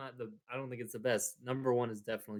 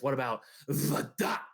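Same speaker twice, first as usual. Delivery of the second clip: lightly voiced cartoon duck; a hint of Daffy Duck lisp; 2 semitones higher than usual. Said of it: "What a great Daffy Duck impression".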